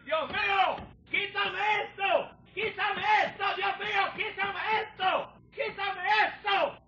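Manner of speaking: high, hushed retelling of a desperate plea; father's anguished cry. Retold or imitated. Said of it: "y gritaba". (el grito angustiado del padre, agudo y contenido)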